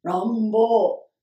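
loud, drawn-out rumble word; elephant rumble. Acted. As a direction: boom it like a big rumbling sound. Elephant rumble sound in story.

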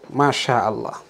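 usual speaking voice

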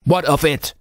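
rough, urgent call to a sailor; gruff voice calling. A narrator's reading. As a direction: call out like a gruff old petty officer. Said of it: "a gruff voice".

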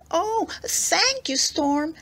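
put-on high, slow, thrilled boy's thanks; delighted thanks. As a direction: stretch it out with pure delight. Boy thrilled to find a boat.

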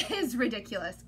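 usual speaking voice

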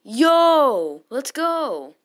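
loud high triumphant yell; victory yell. Real, not acted. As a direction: yell it out at full joy. Big win moment.

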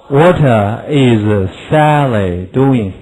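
stretched, deliberate drill question; very slow model question. Shown as a demonstration. Teacher drilling a question word by word. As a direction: draw out every word for copying.

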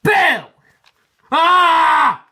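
sudden high, loud spooky yell; loud high boo-yell from a toy train; ghostly shout. Acted. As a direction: leap out and holler to scare. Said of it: "Diesel rolled up ... and went".

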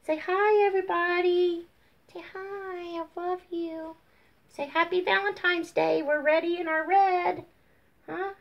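high cutesy voice for the dog; sugary high-pitched baby talk; pet baby talk. Pretend. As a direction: coo greetings on your pet's behalf. Speaking for and to her pet.

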